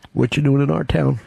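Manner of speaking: lightly put-on strange local, a bit low; strange guy. Host playing a local questioning the visitor, lightly voiced strange guy. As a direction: play an odd townsman questioning an outsider. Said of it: "strange guys who'll be going like this".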